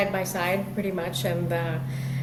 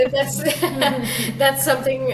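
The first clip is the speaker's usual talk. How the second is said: laughing; 6 semitones higher, 4 dB louder than usual. Real, not acted. Laughs at name correction.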